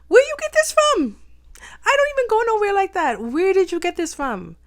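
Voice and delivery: loud, high-pitched indignant outburst; hammed-up incredulous shouting; outraged demand. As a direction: yell it in disbelief, re-enacting the outrage. Retold outrage at catching pneumonia.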